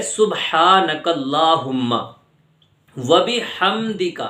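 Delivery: سبحانک اللہم و بحمدک drawn out and exact; slow full recitation. Shown as a demonstration. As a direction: recite each word slowly. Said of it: "تو پورا لفظ ہے".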